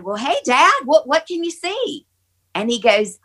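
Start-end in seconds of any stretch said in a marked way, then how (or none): 0.0-2.1 tag eager question. Retold or imitated. Retold eager question to dying father.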